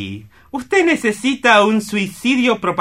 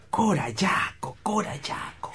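awed whisper of the singer's name; whispered to himself; 10 dB quieter than usual. Acted. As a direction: savour the name in a soft whisper.

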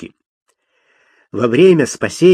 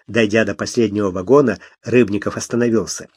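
plain reading voice

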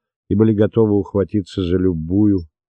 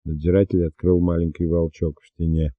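usual speaking voice

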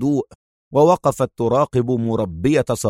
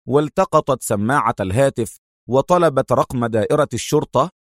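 ordinary delivery

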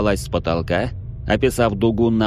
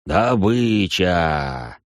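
very slow, drawn-out demon snarl; gloating demon; much slower than usual. Acted. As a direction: savour the word like a predator. Demon spots its prey.

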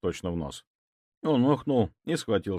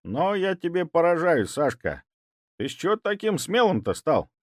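put-on old man voice; 9 semitones higher, 4 dB louder than usual. A narrator's reading.

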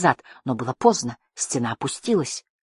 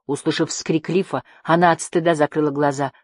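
ordinary delivery, nothing marked

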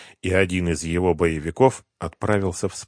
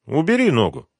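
raised but less forceful command; permitting order; 8 semitones higher, 5 dB louder than usual. A narrator's reading. Gangster letting his henchman ease off, permitting. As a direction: grant it as a brief order. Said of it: "разрешил Митя".

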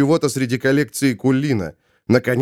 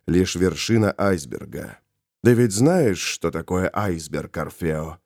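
low, hushed villain voice; quiet, low-pitched sinister explanation; Molokh, composed heretic; 7 semitones lower, 4 dB quieter than usual. Acted. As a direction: speak low and soft, in measured confidence. Molokh speaks quietly to Orfeo.

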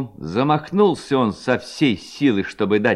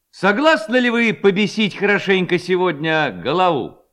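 raised, high-pitched rallying question; rousing proposal; 8 semitones higher, 3 dB louder than usual. A narrator's reading. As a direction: stir the gang up for mischief. Levko incites lads to prank. (задорный призыв повышенным голосом)

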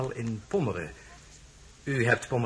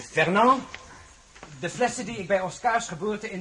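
calling out; 9 semitones higher than usual. Acted. Prince calls his servant to fetch the wine, raised call. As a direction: summon him from across the room.

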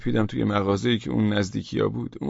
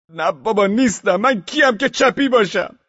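much higher, louder frantic denial; desperate denial; 9 semitones higher, 7 dB louder than usual. Acted. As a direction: plead while being hit. Beaten man denying.

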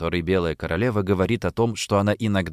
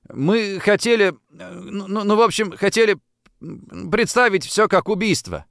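awkward confession; 9 semitones higher than usual. A narrator's reading. Boy confesses prank, embarrassed.

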